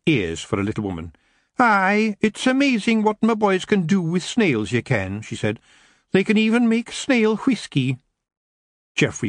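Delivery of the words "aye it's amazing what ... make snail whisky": high Scots pixie mother; boasting of her boys; proud Kelda. Acted.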